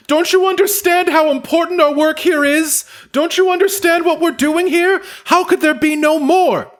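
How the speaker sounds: very high, loud furious gnome; shrill-free high gnome woman ranting at Jorn; angry gnome yelling. Acted. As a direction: screech at the dwarf in outrage.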